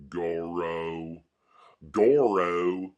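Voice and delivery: mock rural drawl on Goro; rural accent. Showing how country folk say it, rural accent. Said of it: "That's how they talk like in, in rural parts".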